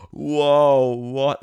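imitating his wife's stunned reaction; amazed whoa. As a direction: blurt it out in disbelief. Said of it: "and she went".

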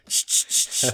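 unvoiced spraying hiss; spray the can in short bursts; imitated spray-can shh. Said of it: "and just go".

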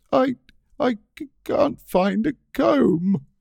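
high, drawn-out, stammering wail; blubbing. Acted. Girlfriend on the verge of tears after a shower, blubbing. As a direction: blubber it out in slow, broken pieces. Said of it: "She starts to blub".

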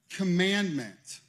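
Commandment drawn out slowly and loud; stressed word. Shown as a demonstration. Preacher stressing Commandment to the congregation. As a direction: lean hard on Commandment. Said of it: "Did you hear that?".

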